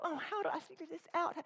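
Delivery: a hint of a puzzled child; confused child. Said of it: "a little child ... doesn't go".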